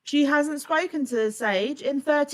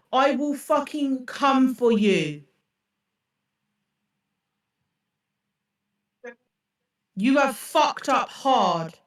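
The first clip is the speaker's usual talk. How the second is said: slow furious threat. Real, not acted. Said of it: "I'm so fucking angry".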